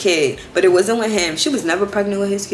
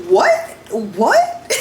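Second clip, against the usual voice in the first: stunned disbelief; 2 semitones higher than usual. Retold or imitated. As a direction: react like you can't believe what you heard. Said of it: "And I'm just like".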